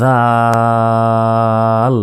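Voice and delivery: জাল held long with the tongue between teeth; জিহ্বা বের করে জাল; tongue-out dhal, drawn out. Shown as a demonstration. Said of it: "এই অক্ষরটি জিহ্বা বের করে".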